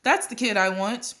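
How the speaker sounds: put-on company president spotting the perfect kid, calling out; excited call. A narrator's reading. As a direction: call out as if you've struck gold. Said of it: "he pointed and called".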